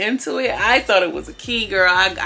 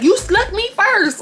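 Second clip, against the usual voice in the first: mock retort; 13 semitones higher, 4 dB louder, slower than usual. Quoting fight retort.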